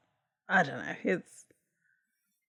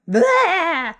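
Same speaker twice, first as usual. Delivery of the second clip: sudden loud, high bleh; ghost jump-scare bleh; 9 semitones higher, 8 dB louder than usual. Retold or imitated.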